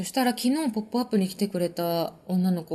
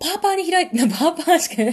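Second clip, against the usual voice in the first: laughing; 9 semitones higher, 5 dB louder, faster than usual. Real, not acted. Laughing over dialect word.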